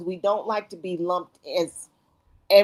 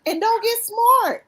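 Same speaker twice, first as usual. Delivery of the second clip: mock scolding; 14 semitones higher, 3 dB louder, slower than usual. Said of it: "I'm like".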